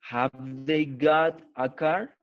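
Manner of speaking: slow, deliberate reading for the class; slow model reading. Shown as a demonstration. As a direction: spell the sentence out slowly like a teacher. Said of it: "teacher models the question slowly". (lectura lenta y marcada de profesora)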